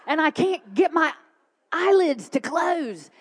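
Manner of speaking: worked-up retold frustration; hammed-up desperation. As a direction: stretch it out, loud and high. Retelling shaking, sleepless night.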